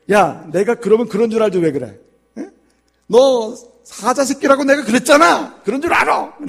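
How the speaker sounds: high, loud scolding father; angry father lion. Acted. Lion father losing patience with his cub, loud scolding. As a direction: yell at the pestering cub. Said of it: "아빠가 화가 난 거예요".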